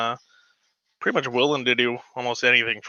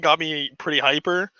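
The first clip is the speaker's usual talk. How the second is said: ordinary talk, nothing marked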